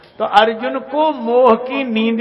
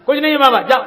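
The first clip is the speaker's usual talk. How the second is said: put-on higher, louder dismissive old woman; an old woman brushing off a beggar at her door; curt refusal; 6 semitones higher, 5 dB louder, faster than usual. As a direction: shoo the beggar away sharply.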